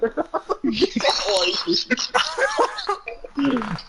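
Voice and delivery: long high-pitched laughing fit; laughter. Real, not acted. Story about dad at bingo.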